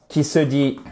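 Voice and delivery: usual speaking voice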